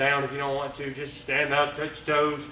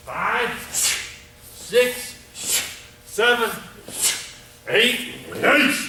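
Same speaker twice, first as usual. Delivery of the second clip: counting kata; 6 semitones higher, much slower than usual. Shown as a demonstration. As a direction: call each count as you do the move. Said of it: "I'm gonna count it, I'm gonna do it slow".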